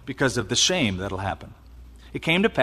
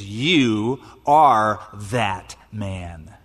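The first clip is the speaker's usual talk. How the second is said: very slow, much lower prophetic pronouncement; solemn accusation; 6 semitones lower, much slower than usual. Pretend. Nathan pointing at David, very slow solemn accusation. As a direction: lay the words down slowly and low.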